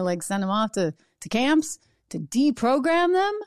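ordinary delivery

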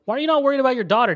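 fast, high, loud retold yell. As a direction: fire it off at her father, fast and loud. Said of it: "And she yells".

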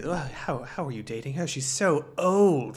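mock the scandalized refrain, dragging it out; repelled disbelief. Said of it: "the common refrain in this movie".

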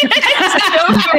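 high, loud laugh through the words; can't stop laughing; slow giggling delight. Real, not acted. Laughing at cruise joke.

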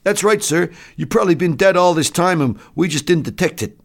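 high, loud soldier's voice; enlisted man chiming in. Acted. Enlisted man confirming to the doctor he's dead, high and loud. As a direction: chime in loudly, pitched high.

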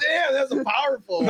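high laughing reaction. Real, not acted. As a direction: laugh through the words. Reacting to loud sound effect.

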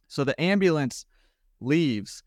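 normal talking voice

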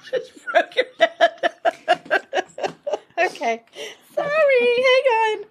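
high shrieking laugh then words; breaking up laughing. Real, not acted. Knocks co-host, cracks up.

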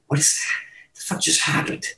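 breathless hushed disbelief; stunned whisper. Retold or imitated. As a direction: whisper it in shock. Stunned by bidding war.

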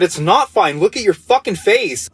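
exasperated mom. Retold or imitated. Mom scolds injured son.

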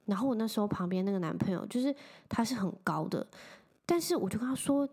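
ordinary delivery

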